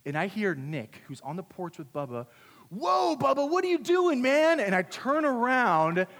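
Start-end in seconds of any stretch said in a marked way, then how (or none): 2.7-4.6 high, loud alarmed call; shocked shout. Retold or imitated. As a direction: act out a startled yell at a buddy. Shocked onlooker calls out in story.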